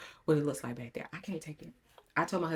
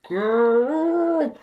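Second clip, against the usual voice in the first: rattled, wailing exclamation; shaken exclamation; 9 semitones higher, 11 dB louder than usual. Real, not acted. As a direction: stretch it out in rattled disbelief. Reliving fright of supposed snake.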